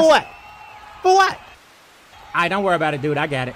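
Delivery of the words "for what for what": mock outraged yell; indignant yell. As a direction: shout at the ref in disbelief. Protesting tech call.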